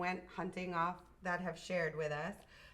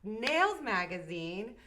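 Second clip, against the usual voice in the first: big drawn-out intro, climbing louder to the name; announcer introduction; 2 semitones higher, 8 dB louder, slower than usual. Real, not acted. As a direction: announce the winner, rising to a shout. Host's big introduction of the guest.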